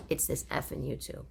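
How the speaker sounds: breathy laugh through the words. Real, not acted. Host laughing while blaming YouTube for her daughter. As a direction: chuckle while you say it.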